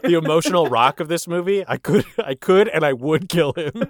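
loud gleeful boast; excited laughing. Real, not acted. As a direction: laugh through a mock threat. Laughing boast about killing.

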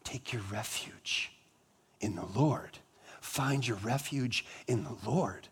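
soft, breathy near-whisper plea; hushed imploring words to his family. Retold or imitated. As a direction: plead gently like a dying wish. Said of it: "implored his family".

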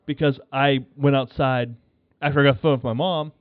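ordinary talk, nothing marked

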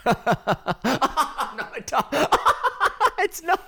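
high squealing laughter; cracking up, laughing through words; laughing hard. Real, not acted. Laughing fit after mute prank.

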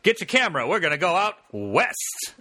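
put-on high, eager old-timey voice; hammed-up pulp hero calling his boy; old-timey adventurer.

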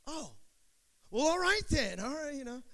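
lightly voiced veteran backing down; imitating old man. Veteran covering his surprise at meeting the pastor, flustered and high. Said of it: "He goes".

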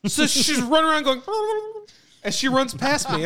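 normal talking voice

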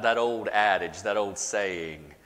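ordinary talk, nothing marked

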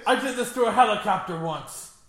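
mock hero brag. Continuing hero voice.

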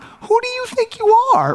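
very high, incredulous squeal; comic falsetto parental disbelief; mock indignation. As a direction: shoot way up in pitch, mock-outraged. Mock-indignant parent answering demanding kids.

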